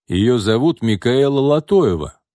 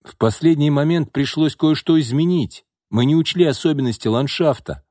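ordinary delivery

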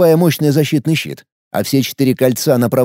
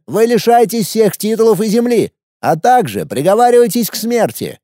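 put-on higher, boyish young emperor; teenage boy voice; 6 semitones higher than usual. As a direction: play an arrogant teenage ruler. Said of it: "произнёс сидящий на троне пацан". (голос подростка-императора)

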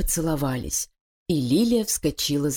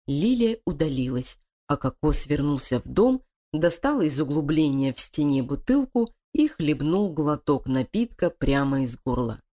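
ordinary delivery, nothing marked

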